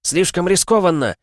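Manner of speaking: high urgent yell; shouted warning. A narrator's reading. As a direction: shout a warning across to another ship. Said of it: "закричала Миас".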